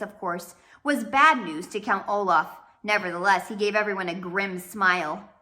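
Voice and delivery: ordinary delivery, nothing marked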